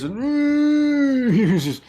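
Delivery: drawn out, high with laughter; breaking up laughing mid-sentence. Real, not acted. GM cracks up narrating, slow high laughing.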